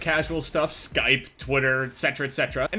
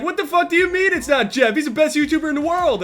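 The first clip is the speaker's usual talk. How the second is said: outraged fans; 8 semitones higher, 4 dB louder than usual. Retold or imitated. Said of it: "People are like".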